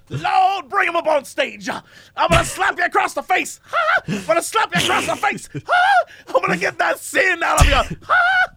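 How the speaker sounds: high shouting preacher with whooping ha. Retold or imitated. Joking about the preacher he'd watch, high shouting whoops. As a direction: slap the sin out, shouting and whooping. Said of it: "you want the [name] preacher".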